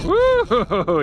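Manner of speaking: loud, high whoop of joy; excited whoop. Real, not acted. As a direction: cheer as the fish bites. Hooked a bass on the line.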